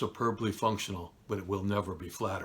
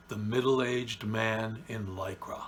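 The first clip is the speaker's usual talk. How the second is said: normal talking voice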